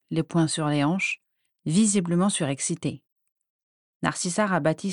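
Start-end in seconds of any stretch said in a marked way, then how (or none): none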